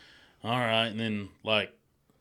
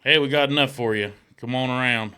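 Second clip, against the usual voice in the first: loud call through the door; 3 semitones higher, 8 dB louder than usual. Retold or imitated. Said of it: "this dude's fucking beating on the door".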